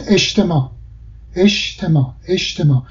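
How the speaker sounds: اجتماع said eshtema, silent final ain; jim as sh. Shown as a demonstration. Linguist demonstrating everyday speech, jim pronounced as sh. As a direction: turn the j of اجتماع into sh.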